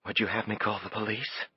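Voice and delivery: quietly sharp woman; calm woman's voice. A narrator's reading.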